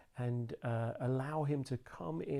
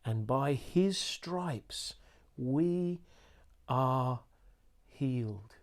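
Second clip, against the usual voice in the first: word by word, slow and deliberate; slow emphatic reading, word by word; very slowly; much slower than usual. Shown as a demonstration. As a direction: pause between we, are and healed. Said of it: "Let me read this very slowly to you".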